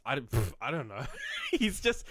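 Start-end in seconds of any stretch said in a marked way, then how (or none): 1.3-2.0 laughing through the words. Real, not acted. Fumbling the plot to a friend, laughing. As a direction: giggle as you start.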